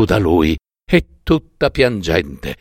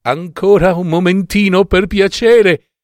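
higher, begging wife's voice; pleading wife; 7 semitones higher, 3 dB louder than usual. A narrator's reading. Wife begs her husband for a moment, pleading. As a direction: plead for time, drawing out the end. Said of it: "gli rispose la moglie".

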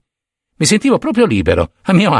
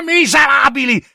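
furious shout; 10 semitones higher than usual. A narrator's reading. Killer screaming at the smirking officers, furious shout. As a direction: hurl the word at them.

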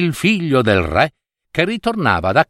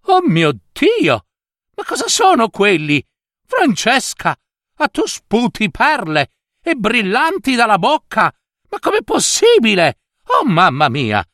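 astonished mother; 8 semitones higher than usual. Acted. Mother to Francesca spitting pearls, astonished.

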